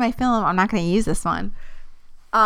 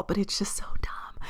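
fast conspiratorial whisper; whispered aside; 12 dB quieter than usual. Real, not acted. Gossiping to viewers about a friend, fast whisper. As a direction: drop to a whisper as if sharing gossip.

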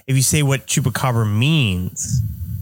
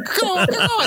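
high laughing crow caw. Pretend. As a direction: squawk like a crow through a laugh. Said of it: "like a crow".

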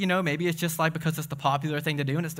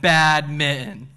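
slow, loud, drawn-out pun; punchline voice; 5 dB louder, much slower than usual. Acted. Pastor landing the cat pun, slow and loud with laughter. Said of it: "I said".

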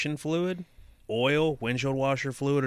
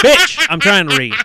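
loud, high real snap; exasperated outburst; 12 semitones higher, 15 dB louder than usual. Real, not acted. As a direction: shout down the giggler interrupting you. Scolding the interrupting listener.

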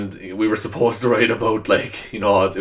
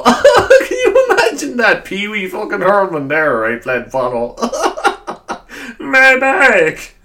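much higher and louder, slow, through real laughter; laughing; 11 semitones higher, 7 dB louder, slower than usual. Real, not acted. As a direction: crack up while picturing it. Laughing at his own joke.

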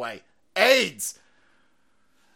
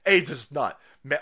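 ordinary delivery

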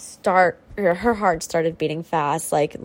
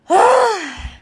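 loud exasperated sigh; 12 semitones higher, 7 dB louder than usual. Real, not acted. Reacting to the news.

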